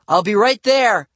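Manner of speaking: high, loud call; shouting. A narrator's reading. Matt shouts to kids out the front door. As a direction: call out to people outside. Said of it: "I shouted to Livy and Bradley".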